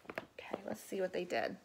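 a breathy whispered aside; whispered okay. Real, not acted. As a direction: secret little okay.

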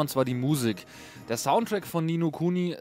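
ordinary delivery

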